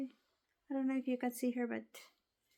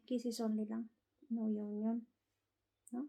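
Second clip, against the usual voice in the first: soft cooing; 3 semitones lower, 6 dB quieter, faster than usual. Real, not acted.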